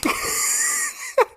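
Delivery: wheezing burst of laughter; airy helpless laugh; breathy laugh. Real, not acted. Collapses laughing.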